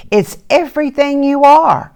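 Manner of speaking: high, loud reassurance; loving mother. Acted. Mother comforts her upset daughter, reassuring. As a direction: lift her spirits with love. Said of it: "said her mother".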